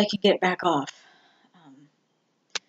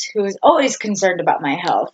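usual speaking voice

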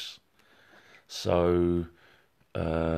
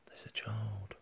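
whisper; 3 semitones higher, 16 dB quieter than usual. Real, not acted. Psychic whispering to Amanda that a child is there. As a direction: drop to a soft whisper as if sensing something.

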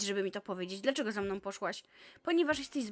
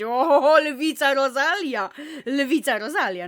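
loud, slow, hammed-up ringside call; loud teasing fanfare; mock announcement; 2 semitones higher, 12 dB louder, slower than usual. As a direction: announce her like a wrestler entering. Mocking announcement of Rosalia.

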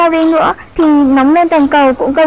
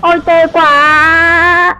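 dismayed groan; much slower than usual. Acted. Child reacts to bad news.

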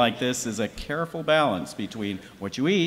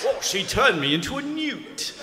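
higher, whiny British villager; Monty Python British; 8 semitones higher than usual. Retold or imitated. Host quoting Monty Python in a high mock British whine. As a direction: complain like a Python peasant. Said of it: "I break into Monty Python".

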